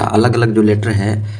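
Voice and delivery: ordinary talk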